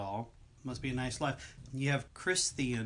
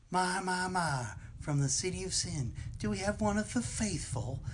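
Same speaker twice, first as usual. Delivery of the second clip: southern preacher; 6 semitones higher than usual. Retold or imitated. The cannibal preacher welcoming the man from the city of sin, in a higher southern voice. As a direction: greet the stranger like a sly preacher. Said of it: "like a southern preacher".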